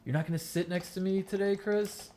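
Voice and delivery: put-on a girl teasingly yelling across the classroom; mock yell across class. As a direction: call him out in front of everyone. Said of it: "she would yell out in class".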